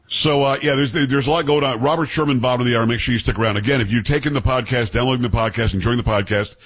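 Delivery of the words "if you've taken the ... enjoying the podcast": ordinary delivery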